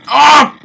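sharp high shout of shock; raging yelp. Real, not acted. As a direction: yelp out loud in rage. Gamer raging at death.

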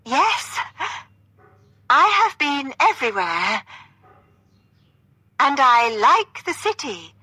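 high, loud stagey answer; theatrical. A narrator's reading. Catherine playing along with her partner, theatrically. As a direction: declaim it in grand separate phrases.

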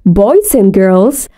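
usual speaking voice

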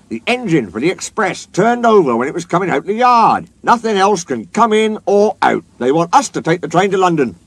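high, loud, lively news-breaking; excited. A narrator's reading. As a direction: blurt out the big news. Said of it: "looking excited".

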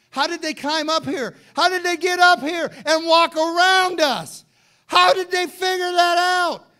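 loud, high, stunned city folk crying out; astonished shout. Retold or imitated. The people of Jericho, shocked at Israel marching around, shouting high and loud. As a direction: shout it like the people of Jericho can't believe their eyes.

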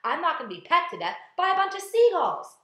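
loud, high panicked shout; crying out. A narrator's reading. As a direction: yell it in protest. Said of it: "cried the earthworm".

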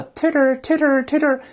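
high, repeated bird-like twitter; chirping birds. Shown as a demonstration. Teacher mimics chattering birds, high repeated chirps. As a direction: chirp the word titter in a string. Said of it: "来自鸟儿叽叽喳喳的叫".

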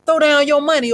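high, loud threat; threatening. A narrator's reading. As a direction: bark the demand up the stairs. Thug threatening the old man.

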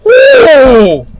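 high woo sliding downward; excited whoop. Real, not acted. Viewer cheering the fight, excited whoop. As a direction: let out a high falling whoop.

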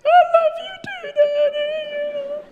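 put-on very high, wailing little girl voice; imitated tearful daughter. Daughter answering Daddy's hug after spanking, mock high-pitched wail. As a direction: squeal it like a sobbing child, hammed up.

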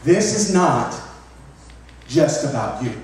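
emphatic repeat for the congregation; stress on This. Shown as a demonstration. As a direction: punch "This is not", let "just about you" fall. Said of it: "Let me repeat that again".

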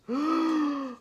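high, loud gasp; startled gasp. A narrator's reading. Storyteller finds six cookie pieces, startled gasp. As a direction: gasp at the surprising count.

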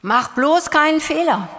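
high, loud mock warning from the school; stern school warning. School drilling pupils in fear of mistakes, mock stern warning. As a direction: bark it like a strict teacher scolding pupils. Voicing school's hidden message.

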